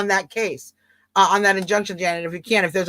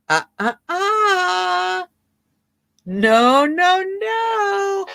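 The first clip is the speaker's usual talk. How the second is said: drawn-out singsong no, rising high; playful scolding refusal; 8 semitones higher, much slower than usual. Acted. Host correcting the doubting viewer, playful scolding refusal. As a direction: stretch each last sound up high, teasingly. Playfully rebuts the imagined objection.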